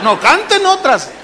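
urgent mock command, stretched out; acted frantic order. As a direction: imitate a harried organizer stalling for time. Organizer stalls for more songs.

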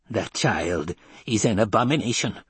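put-on old woman's voice, pronouncing judgement; vehement old woman. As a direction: denounce with loathing.

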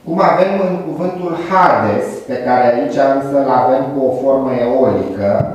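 ordinary delivery

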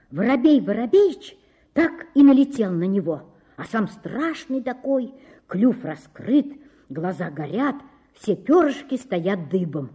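usual speaking voice

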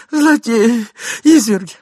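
high, loud wail of outrage; lady's cry. A narrator's reading. Lady collapsing on the couch, high outraged cry. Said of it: "кричала дама".

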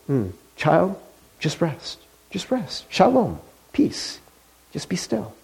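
almost whispered, tender reassurance; gentle divine voice. Acted. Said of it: "he hears that voice".

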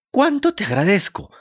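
put-on grateful little wooden boy; high puppet voice. A narrator's reading. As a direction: thank him in a small high voice. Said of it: "dijo la marioneta".

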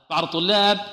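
usual speaking voice

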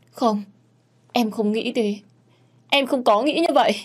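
high, stammering tearful protest; frightened girl denying through tears; tearful denial. Acted. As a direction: deny it in a panicked rush. Hồng tearfully denies to cậu Tư.

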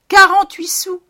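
Quarante-huit sous squealed high and loud; high exclaimed price. Acted. Wife names the price.

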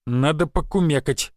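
pondering hum, then a measured reply; thoughtful. A narrator's reading. Sawmill owner considering a building request, thoughtful. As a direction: mull it over aloud. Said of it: "задумчиво ответил он".

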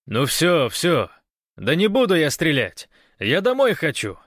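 high, loud placating; shouted protest. A narrator's reading. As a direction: call out that you mean no harm. Guest shouts back to guards.

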